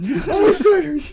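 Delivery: high, slow, drawn-out laughing whine. Real, not acted. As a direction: stretch it out in helpless laughter.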